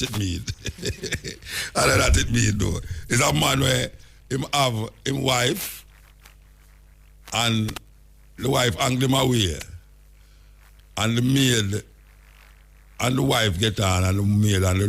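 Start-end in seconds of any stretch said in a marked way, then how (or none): none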